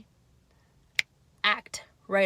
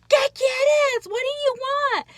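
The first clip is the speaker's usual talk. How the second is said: high, loud exasperated yell; snapping at the child; 9 dB louder than usual. Retold or imitated. Mom snapping at nagging child, loud exasperated yell. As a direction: bark it at the nagging kid. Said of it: "And that's our reptilian brain".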